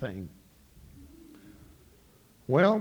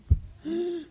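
high sharp wheezing gasp; horse gasp; 12 semitones higher than usual. Acted. Nag wheezing as it staggers down the road, high gasp.

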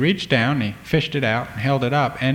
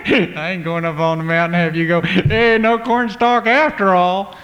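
parents mocking their son's tall tale; teasing mockery; 7 semitones higher, 4 dB louder than usual. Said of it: "smirky grin".

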